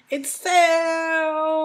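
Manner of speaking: high, loud thrilled cry; excited cry. Real, not acted. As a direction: squeal about the sales. Excited about Belgian sales.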